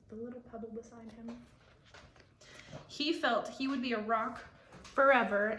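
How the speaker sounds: plain reading voice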